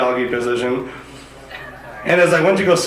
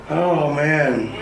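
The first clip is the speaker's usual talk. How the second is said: ordinary delivery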